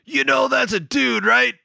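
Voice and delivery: high imitated yell at passers-by; mock yell. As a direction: shout it at strangers on the street. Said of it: "he screams at this guy".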